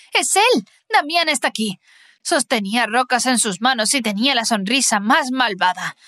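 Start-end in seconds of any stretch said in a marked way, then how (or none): 0.1-1.8 high, startled cry of alarm; frightened cry. Acted. Alice seeing Damián at the window, frightened cry. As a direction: blurt it out in sudden fright. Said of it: "Grité y salté".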